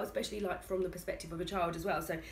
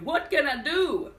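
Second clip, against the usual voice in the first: mock despair; 7 semitones higher, 8 dB louder, slower than usual. Real, not acted. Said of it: "asking herself despairingly".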